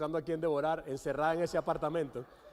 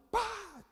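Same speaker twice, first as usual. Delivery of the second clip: sharp high "pa!" for a slap; imitated smack; 13 semitones higher than usual. Tired parent taking it out on the child, imitated smack. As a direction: pop out a sudden slap noise.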